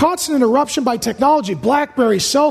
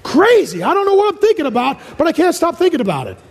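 higher and rushed, a mind going everywhere; scattered rambling; 4 semitones higher than usual. Retold or imitated. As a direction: gabble it as if thoughts won't stop. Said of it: "You're like".

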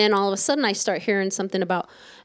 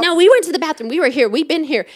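loud, raised-pitch retold objection; protesting; 6 semitones higher, 6 dB louder, faster than usual. Retelling a quarrel over places in line, loud protest. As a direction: insist on it loudly, pitched up.

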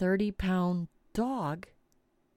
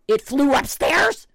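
loud, higher incredulous question; alarmed disbelief; 4 semitones higher, 7 dB louder than usual. Retold or imitated. Mother learning the bat flew upstairs, loudly incredulous. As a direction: blurt it out, rising in mock alarm.

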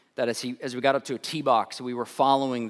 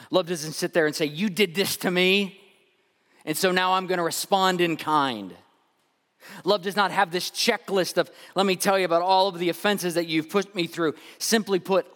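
loud, higher preaching build; 7 semitones higher, 6 dB louder than usual. Acted. Pastor preaching on keeping records of wrong, loud. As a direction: press the point on the congregation.

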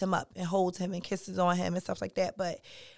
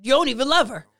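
higher, louder taunting voice; mock accusing inner voice; 4 semitones higher, 4 dB louder than usual. Voicing his inner critic for a friend, a mock accusing taunt. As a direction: jab at him like a scornful conscience.

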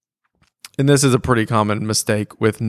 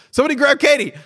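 retold urgent call; 13 semitones higher, much faster than usual. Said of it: "somebody goes".

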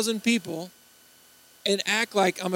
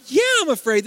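higher-pitched, mock-frightened protest; indignant fear; 7 semitones higher, 4 dB louder than usual. Preacher answering those laughing at his fright, mock-frightened protest. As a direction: own up to fear in a hammed-up way.

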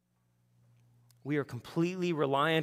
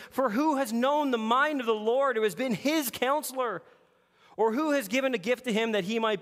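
loud, higher, exultant praise; exultant scripture reading; 7 semitones higher, 4 dB louder than usual. Acted. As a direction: let the praise burst out. Reads scripture with rising praise.

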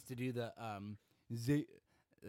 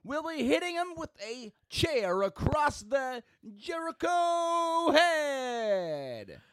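much higher hype ring-announcer call; 12 semitones higher, 6 dB louder, slower than usual. Acted. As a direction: announce the fight to the arena.

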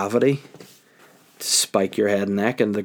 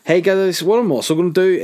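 higher, louder hey to the viewers; bright vlogger call; 8 semitones higher, 5 dB louder than usual. Real, not acted. Addressing his viewers, bright and loud. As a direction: perk up for the audience.

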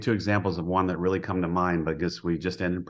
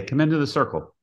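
mild yell, pitched up; retold call-out; 6 semitones higher, faster than usual. Coach to a guest near the field, retold light yell. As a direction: call a guest over, only half raising the voice. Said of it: "I always yell at them".

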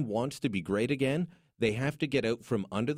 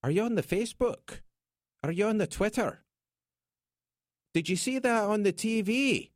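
higher, teasing take on the Twitter with a stressed the; mock dad questions; 10 semitones higher than usual. Host mocking his dad's questions about the Twitter, teasing higher voice. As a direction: mimic an old dad who says the Twitter. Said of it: "Makes me think of my dad".

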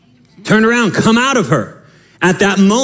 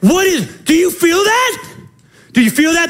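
startled prisoner; 10 semitones higher than usual. Retold or imitated. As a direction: shout it like the floor is moving. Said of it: "other prisoners are sitting there like".

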